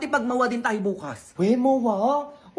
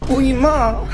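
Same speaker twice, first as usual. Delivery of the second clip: loud cry of protest to mom; 10 dB louder than usual. Acted. Son loudly protests as mother gives him away. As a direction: call out to stop her.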